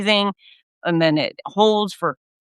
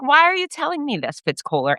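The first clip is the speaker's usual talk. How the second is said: imagined viewer asking; 10 semitones higher than usual. Retold or imitated. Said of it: "you might be like".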